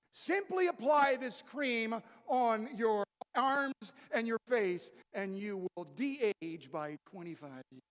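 oversell it like a beauty commercial; mock cream ad. Preacher mocking a beauty cream ad, slow salesy pitch.

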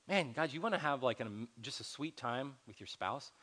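ordinary talk, nothing marked

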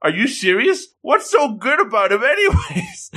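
high-pitched mock incredulity; hammed-up shocked girlfriend; shocked disbelief. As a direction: rush in, then climb to a squeal. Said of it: "And this shocks Saki-chan".

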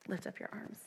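soft, hushed divine command; quiet urging whisper; gentle whisper. Retold or imitated. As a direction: whisper it tenderly and patiently. God's gentle whisper again.

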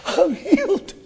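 shouting through tears; ecstatic cry. Real, not acted. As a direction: proclaim healing near tears. Climax of testimony.

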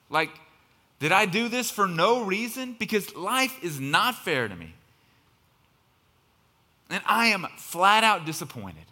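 loud, high, exasperated preaching; frustrated complaint. Acted. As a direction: let the anger at the unfairness burst out. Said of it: "the mantra we keep saying".

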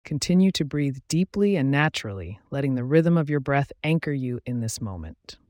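measured guided-visualization delivery with long pauses; guided meditation. Acted. Host guiding listeners into a relaxing visualization, calm meditation voice. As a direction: guide the listener gently through each image, pausing between steps.

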